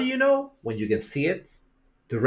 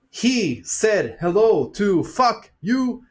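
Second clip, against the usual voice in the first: slow, high, word-by-word delivery; constructed sentence; 7 semitones higher, much slower than usual. Pretend. Mimics people misreading an edited clip, disjointed words. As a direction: say each word separately, as if spliced together. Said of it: "a constructed sentence".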